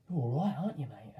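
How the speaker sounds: put-on London accent. Quoting the New Zealand prop in a London accent. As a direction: throw the line out like a London lad. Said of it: "in a London accent".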